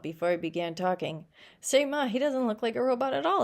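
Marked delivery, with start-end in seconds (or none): 1.6-3.4 put-on lightly raised, childlike; a touch of a kid tugging at mum; child's voice. A narrator's reading. Child calling to his mother, lightly voiced. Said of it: "a child said".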